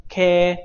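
舌尖抵住下齿龈读K; model ke. Shown as a demonstration. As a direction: drill K clearly. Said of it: "注意舌尖抵住下齿龈啊".

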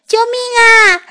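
high cry for help; scared cry. Acted. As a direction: squeal in panic.

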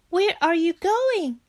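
high, worried Frog voice; anxious. A narrator's reading. Frog sees Bear up early, anxious. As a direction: ask it urgently, pitch jumping up. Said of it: "asked Frog anxiously".

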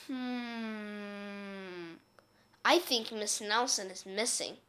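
a detective quietly puzzling over the case; thoughtful detective. Acted. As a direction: state the obvious like a slow detective. Detective concludes.

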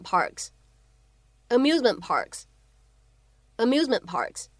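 "amusement parks" with the t dropped before "parks"; loss of plosion demo. Shown as a demonstration. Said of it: "我们可以读成amusement parks". (示范"amusement parks"失去爆破)

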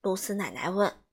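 ordinary delivery, nothing marked

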